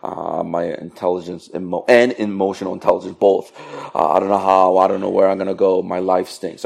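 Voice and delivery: low, soft, defeated mumbling; mock-miserable, sinking low and quiet; dejected self-pity. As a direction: mope through it in a monotone. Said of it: "Some of you are like, "I suck".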